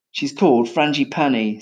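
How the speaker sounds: plain reading voice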